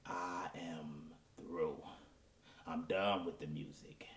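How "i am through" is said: slow, drawn-out whisper, much softer; a hammed-up whispered farewell to the crowd; dramatic whisper. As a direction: whisper the exit slowly for drama. Singer quitting dramatically.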